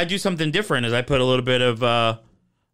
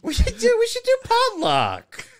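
squeaky, eager and much higher; excited high voice. Real, not acted. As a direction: pitch it way up with glee.

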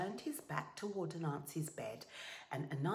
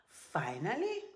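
usual speaking voice

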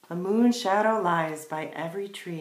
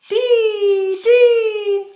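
high, loud sustained bird call; piercing held chirps; chickadee call; 16 dB louder than usual. A narrator's reading. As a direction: sing out like a chickadee.

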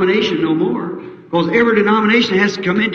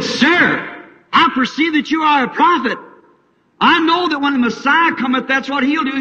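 high, pleading desperate woman; desperate plea; 10 semitones higher than usual. Acted. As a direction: beg him, voice rising.

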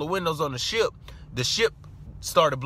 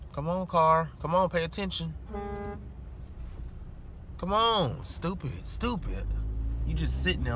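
quiet muttered insult at traffic; real road annoyance, quiet; muttered driver gripe; 9 dB quieter than usual. Real, not acted. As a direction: grumble under your breath at the car ahead.

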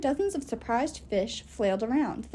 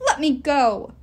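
louder pleading wail; wailing fish; 3 semitones higher, 8 dB louder, slower than usual. Acted. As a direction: cry out to be let go. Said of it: "wailed the fish".

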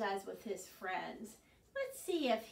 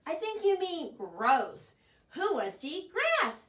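higher, louder scornful protest; disgusted; 5 semitones higher, 7 dB louder than usual. Acted. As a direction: turn up your beak at it. Bird scorns the grass.

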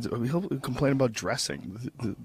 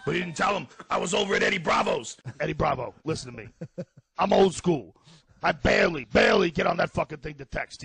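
put-on higher-pitched, exasperated old-school comic; Joey Diaz; 5 semitones higher than usual. Impersonating the friend excusing a missed show, exasperated. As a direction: play a defensive, sarcastic loudmouth. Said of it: "Diaz complains about shit".

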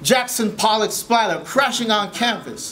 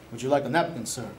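deep attendant voice; 11 semitones lower, 7 dB quieter, faster than usual. Retold or imitated.

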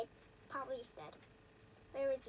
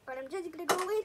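ordinary delivery

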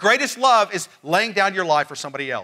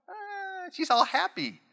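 sudden high sing-song coo; high cooing baby talk; 13 semitones higher, 7 dB quieter, slower than usual. Retold or imitated. Father cooing at his wide-awake baby daughter, high sing-song. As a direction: coo like talking to a happy baby.

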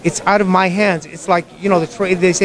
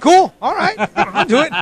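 much higher, eager and laughing; excited; 9 semitones higher than usual. Retold or imitated. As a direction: jump at the offer with a laugh. Eager reply to the offer.

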